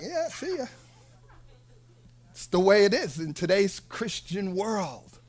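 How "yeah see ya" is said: mock breezy send-off. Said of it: "looking at you and me from the rear mirror".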